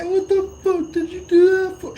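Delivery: loud, very high squealed complaint; hammed-up hurt squeal; mock high-pitched protest. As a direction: wail it as if just smacked. Acting out his protest after being hit.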